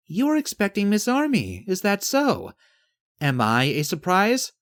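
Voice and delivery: high, questioning character voice; teasing Yvette. Acted.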